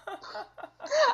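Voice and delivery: high burst of laughter; embarrassed high laugh. Real, not acted. Streamer laughs after co-host yelled on air, embarrassed high laugh. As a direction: laugh it off in shock.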